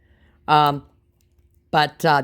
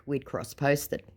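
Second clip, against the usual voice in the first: normal talking voice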